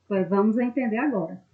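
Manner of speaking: usual speaking voice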